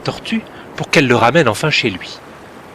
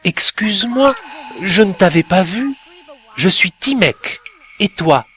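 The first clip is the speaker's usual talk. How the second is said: put-on apologetic boy; 7 semitones higher than usual. A narrator's reading.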